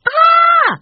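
loud, very high-pitched scream; startled scream. A narrator's reading. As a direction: shriek in sudden fright. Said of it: "the five children screamed all at once".

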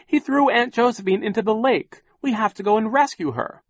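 high, loud, urgent accusation; shouted protest. A narrator's reading. Violet accuses Sham and urges a rescue, shouting. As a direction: shout it out in alarm. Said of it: "Violet shouted".

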